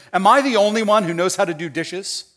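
loud, exasperated rhetorical question; accusing question. Acted. Dad to family relaxing while dishes pile up, accusing. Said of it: "begins the process with an accu question".

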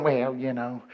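soft, low, noncommittal trailing; timid mumbling excuse. Acted. Timid believers excusing themselves, soft and low. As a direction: back off softly, half-hearted. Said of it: "our timidity is testimony".

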